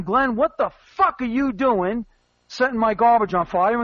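usual speaking voice

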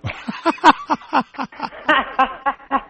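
high staccato run of laughter; scoffing laugh. Real, not acted. Caller mocking the small earthquake claim, scoffing laugh. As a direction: laugh it off in quick high bursts.

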